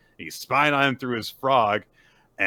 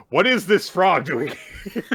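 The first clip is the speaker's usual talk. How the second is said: higher, louder puzzled outburst with a laugh; confused villain; 9 semitones higher, 8 dB louder than usual. Retold or imitated. Code discovering the spy frog, loud puzzled outburst. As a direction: sputter at the strange frog. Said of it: "Code's meanwhile like".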